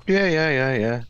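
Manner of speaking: high, loud dismissive yeah yeah; sarcastic yeah yeah yeah; mocking yeah yeah. Real, not acted. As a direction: brush off the whining dog. Mocking whining dog.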